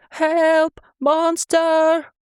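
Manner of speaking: high, loud scream for help; frightened scream. A narrator's reading. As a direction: shriek in terror at the monster. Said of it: "filling the corridor with a scream".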